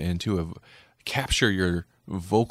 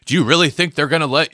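raised, louder challenge; voicing the Doctor; 6 semitones higher, 5 dB louder than usual. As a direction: challenge him, voice up. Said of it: "the doctor's like".

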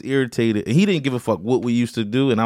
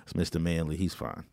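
much lower, softer, brushing it off; mock dismissive shrug; 8 semitones lower, 13 dB quieter than usual. The school waving off a teacher's grab, low dismissive mumble. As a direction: mumble it like the school couldn't care less. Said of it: "It was like".

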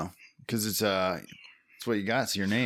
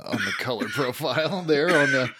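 laughing; 6 semitones higher, 6 dB louder than usual. Real, not acted. Laughing through cough while high.

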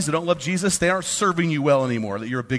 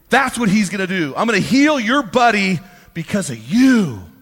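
loud, high preaching to the men; loud, fired-up sermon voice; full-force preaching; 7 semitones higher, 8 dB louder than usual. Acted. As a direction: drive the point home from the pulpit.

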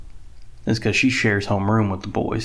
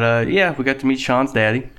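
usual speaking voice

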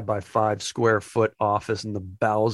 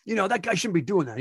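manager voice; 7 semitones higher than usual. Retold or imitated. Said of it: "He's like".

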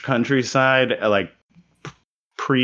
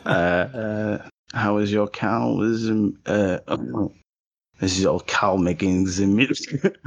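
lightly put-on French accent, unhurried; slower than usual. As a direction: play a French villager knocking next door, hammy French accent. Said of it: "do it again with a French accent".

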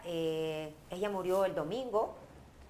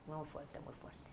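quiet sad; 5 semitones lower, 16 dB quieter, slower than usual. Real, not acted. Sad story, fading out.

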